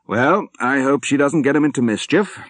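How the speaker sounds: put-on hearty old general. A narrator's reading. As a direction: play the cheerful old soldier who has made peace with dying. General voiced again.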